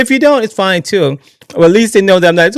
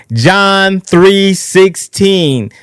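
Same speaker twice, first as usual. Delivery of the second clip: drawn out slowly; 4 semitones higher, much slower than usual. Shown as a demonstration. Host proclaiming the Bible verse, very slow and drawn out. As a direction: stretch John three sixteen out very slowly.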